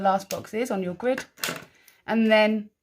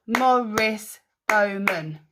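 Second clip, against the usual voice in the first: "Morris Bowman" slowly split into syllables; "Morris Bowman" slow and measured, each syllable apart; much slower than usual. Shown as a demonstration. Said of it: "How many was that?".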